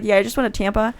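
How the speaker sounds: quick, casual run-through; said fast. Shown as a demonstration. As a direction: rattle it off in one breath. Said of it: "if I say it fast".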